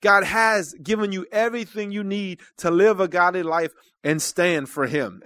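ordinary talk, nothing marked

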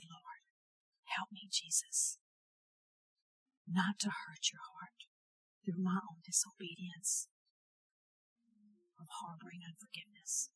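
soft, unvoiced plea to the Lord; hushed, pleading prayer; whispered prayer. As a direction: whisper it as a humble, surrendered prayer. Her surrendered prayer retold.

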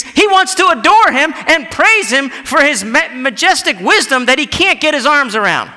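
high, loud, impassioned preacher; ringing, insistent pulpit delivery; preaching. Real, not acted. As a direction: proclaim it with full fervour. Preaches at full force.

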